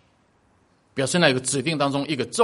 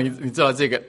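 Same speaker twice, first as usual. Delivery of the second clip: usual speaking voice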